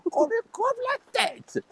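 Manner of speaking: very high, shrieked royal command; Queen of Hearts. Acted. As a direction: squeal it out like a storybook tyrant queen. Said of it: "la Reine de Cœur".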